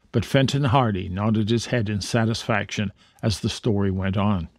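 ordinary delivery

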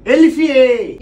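shouted, exasperated retort; angry outburst. Acted. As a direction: throw the question back furiously. Husband erupts over the tea.

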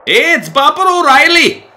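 high, loud, drawn-out bad Irish accent veering Scottish; failed Irish accent, Scottish-sounding. Player attempts Irish for the batter's name, Scottish-sounding accent. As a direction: aim for Irish and land on Scottish, loudly. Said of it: "That was Scottish".

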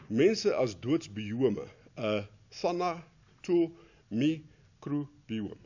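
"thanatomicrobiome" sounded out very slowly, syllable by syllable; stumbling pronunciation. Shown as a demonstration. Lecturer to class, fumbling through "thanatomicrobiome" syllable by syllable, very slowly. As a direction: drag out each syllable of "thanatomicrobiome" as you struggle with the word. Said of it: "maar dis nie hoe jy dit uitspreek nie".

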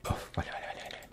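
barely audible, unvoiced whisper. Real, not acted. Player creeping through the game, whispering. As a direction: whisper it under your breath. Sneaking past the chaser in game.

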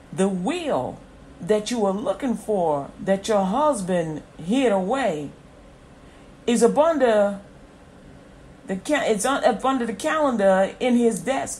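emphatic, rising in force; prophetic preaching. Acted. Prophet telling a widow where the will is, forceful preaching. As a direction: proclaim each detail with conviction.

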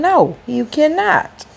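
high, slow, firm no; a firm, high no drawn out slow; firm refusal. Retold or imitated. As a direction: lay down the law to him. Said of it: "And I told him".

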